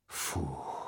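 very soft exhaled relief; whispered. A narrator's reading. Prince alone before his reflection, whispering. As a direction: let it out on a hushed breath. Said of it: "прошептал он".

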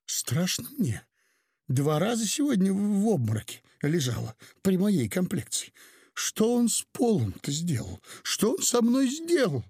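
high, distressed wail building; frightened, near tears. A narrator's reading. Frightened mother complains to the professor, high and close to tears. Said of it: "Тут добрая женщина зарыдала".

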